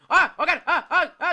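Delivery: high, loud terrified yelps; panicked screaming. Real, not acted. Jump scare, screaming and fleeing.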